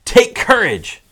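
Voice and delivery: high, loud exhortation; acted, projected command; rallying call. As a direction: urge them to be brave. Jesus' command, quoted with force.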